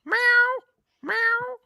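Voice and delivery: high, squeaky meowing like a kitten; cat impression. Shown as a demonstration. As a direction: meow like a little cat. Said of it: "my cat impression".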